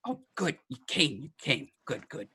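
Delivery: a fussy gnome glad the package came; excited gnome. Acted. Gnome greeting the courier, high and eager. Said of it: "And he's like".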